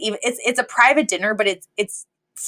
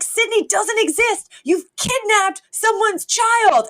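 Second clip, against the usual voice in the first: much higher, slow mock horrified voice; Niles, hammed-up horror; 14 semitones higher, slower than usual. Butler telling her she kidnapped a child, high hammed-up panic. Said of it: "He's like".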